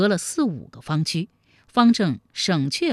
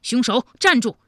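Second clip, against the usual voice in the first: higher-pitched urgent call; raised voice, calling after a fugitive; hurried shout; 5 semitones higher, 3 dB louder than usual. A narrator's reading. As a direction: shout to stop someone getting away. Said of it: "忙叫道".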